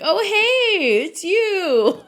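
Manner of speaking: high, loud pleasantly surprised greeting; surprised recognition. Retold or imitated. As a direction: spot someone you know and light up. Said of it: "someone's like".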